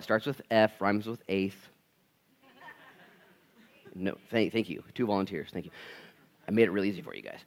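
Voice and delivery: ordinary delivery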